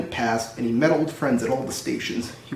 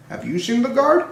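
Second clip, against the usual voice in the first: asking voice; 9 semitones higher than usual. A narrator's reading. Asking the station master.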